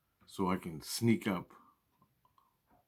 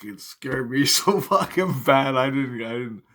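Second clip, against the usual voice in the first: laughing; 5 semitones higher, 7 dB louder than usual. Real, not acted. Laughing about the scare.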